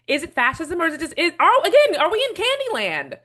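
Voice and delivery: rising to a high, loud exasperated outburst; getting emotional. Real, not acted. Commentator reacting to a debate, high and loud with exasperation. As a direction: let the disbelief boil over into a near shout. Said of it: "Sorry, I'm getting emotional".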